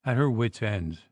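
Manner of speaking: usual speaking voice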